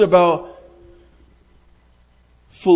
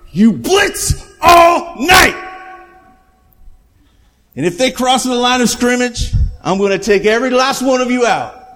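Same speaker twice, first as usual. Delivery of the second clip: rally the defense like a coach before a stand; fired up; 4 semitones higher than usual. Retold or imitated. Coach firing up his defense, shouting. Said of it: "he just gets all fired up".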